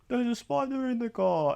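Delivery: high put-on mum voice; mimicked mum, high and put-on; mock mum voice. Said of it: "my mum's not Australian".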